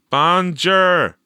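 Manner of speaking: put-on a few brave kids answering; students' bonjour. A narrator's reading. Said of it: "braved a few students".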